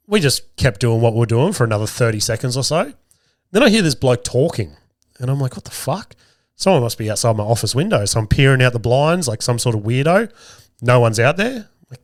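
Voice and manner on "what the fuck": hushed, high-pitched disbelief; quietly baffled, pitch jumping up; baffled reaction. Retold or imitated. Man hears a bloke talking nearby, baffled. Retelling hearing a stranger talking.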